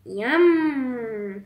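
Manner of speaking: long, savouring yum; pleased wolf. A narrator's reading. Wolf spotting the little girl, pleased savouring. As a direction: draw it out with relish. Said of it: "tout content".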